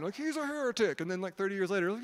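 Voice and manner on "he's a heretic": high-pitched indignant accusation; mock-mad, high-pitched objection; mock outrage. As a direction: imitate an outraged critic, voice shooting up high. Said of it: "everyone got real mad".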